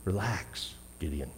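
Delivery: softly reassuring, fading to near hush; soothing command. Retold or imitated. The Lord softly reassures frightened Gideon, hushed and tender. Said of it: "the command".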